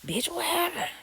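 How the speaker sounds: high-pitched shocked "what happened". Retold or imitated. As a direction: jump up high, alarmed for your friend. Alarmed friend asks what happened.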